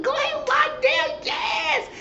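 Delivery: very high, loud squeal of delight; squealing cheer. Real, not acted. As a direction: shriek it like a fan cheering them on. Cheering the couple on.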